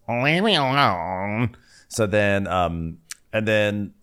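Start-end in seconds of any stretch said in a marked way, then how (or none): 0.1-1.6 slow, stretched-out mock brush-off; Meredith voice. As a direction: drag out each word in a put-on voice. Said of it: "then Meredith tells us".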